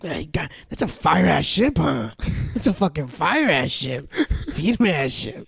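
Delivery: coaxing lowkey hype about the ship; lightly voiced greedy dad. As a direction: talk the kid into it, slyly. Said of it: "It was like".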